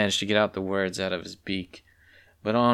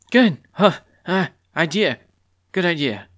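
flustered stumbling; 9 semitones higher, 7 dB louder than usual. A narrator's reading.

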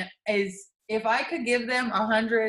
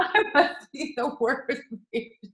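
laughing cry; 5 semitones higher than usual. Real, not acted. Laughing at her own behaviour.

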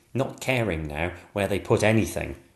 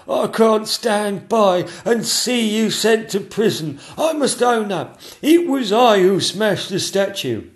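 high, loud, slow confession; anguished courtier; 12 semitones higher, 7 dB louder, slower than usual. Acted. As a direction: own up nobly. Chamberlain confessing.

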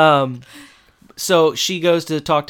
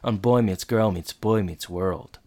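mock announcer; 2 semitones lower than usual. Podcaster teasing the rest of the episode, mock announcer. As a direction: draw it out like a cliffhanger voice-over.

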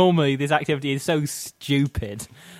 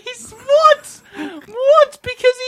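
high-pitched, loud, cracking with laughter; incredulous outburst; 9 dB louder, much slower than usual. Real, not acted. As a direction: squeal in disbelief. Incredulous at friend's silly joke answer.

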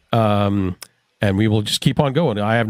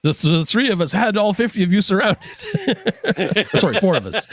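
laughing; 9 semitones higher than usual. Real, not acted. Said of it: "Oh my God, I can't math".

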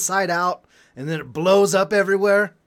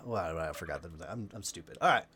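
ordinary talk

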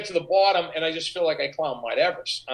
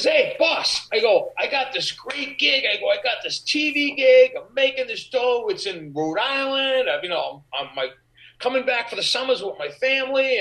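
put-on higher, brighter eager young guy selling his news; proudly telling the boss about the TV gig, mock excited; eager pitch; 6 semitones higher than usual.

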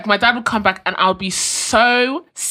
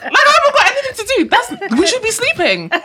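mock dad; 5 dB louder than usual. Dad's reply about eleven.

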